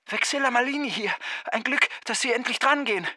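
a hurried woman calling from afar; distant phone voice. Acted. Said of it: "Stimme sagte von weit her". (ferne, schnelle Telefonstimme)

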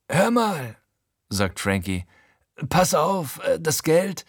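deutlich höher, drängelnder Kleinganove; Frankie, hohe Stimme. Acted. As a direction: wie ein nervöser Gauner, der einen alten Freund anpumpt. Frankie leiert dem Erzähler Geld ab. (Frankie bittet den Erzähler nervös um Geld, hoch und drängend)

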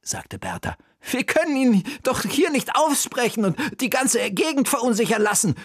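urgent plea. Acted. Bertha urging in crisis.

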